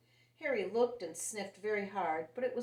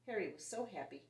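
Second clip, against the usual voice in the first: plain reading voice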